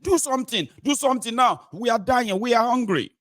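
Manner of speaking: high-pitched acted pleading; desperate plea. Hungry people asking the government, high desperate plea. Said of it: "they are asking the government".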